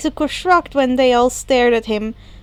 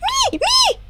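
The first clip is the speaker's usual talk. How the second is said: much higher, shouted "Me! Me!"; eager volunteering. A narrator's reading. Himiko volunteers to follow Izuku, much higher excited shout. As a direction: squeal it out, hand up, begging to be picked.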